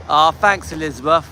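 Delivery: ordinary delivery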